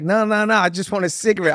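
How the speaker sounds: high, hurried mock panic; retold stranger pleading high to avoid a fight; panicked protest. Guy panicking, backing off.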